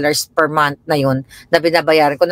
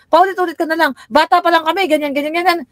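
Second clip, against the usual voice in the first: whiny complaint; 10 semitones higher than usual. Retold or imitated. Kids complain, retold.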